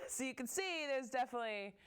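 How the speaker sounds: laugh, then carry the amusement into the words; amused laughing. Real, not acted. Laughs at her own photo.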